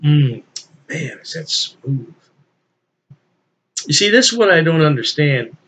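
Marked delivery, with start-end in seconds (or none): none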